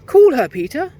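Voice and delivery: put-on very high, fast, frightened girl voice; panicked little girl; trembling girl. A narrator's reading. Mary begging for help after Fenella vanishes, trembling.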